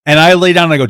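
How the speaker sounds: ordinary delivery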